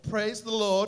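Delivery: loud, ringing Praise the Lord; with an exclamation mark. Shown as a demonstration. Pastor leading the congregation, loudly exclaimed. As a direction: proclaim it like it ends in an exclamation mark. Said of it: "could you say that with the exclamation mark".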